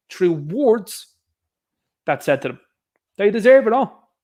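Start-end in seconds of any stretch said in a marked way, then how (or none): 3.2-4.1 loud, forceful declaration; loud emphatic. Real, not acted. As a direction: hammer it home. Ranting loudly at critics.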